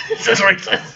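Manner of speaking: talking through a laughing fit. Real, not acted. Reader embarrassed by own accent, laughing. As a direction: crack up at yourself.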